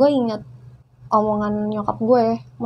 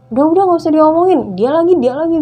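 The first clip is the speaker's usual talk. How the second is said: fast, loud, higher nagging; loud nagging friend; 6 semitones higher, 9 dB louder, faster than usual. Retold or imitated. Friend pressing the speaker to drop the topic, loud and fast. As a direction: rattle it off like a pushy friend.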